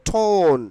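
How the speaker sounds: loud, stretched টোন with puffed T; model pronunciation. Shown as a demonstration. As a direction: hold the vowel in টোন. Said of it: "টোনের উচ্চারণ কি হবে?".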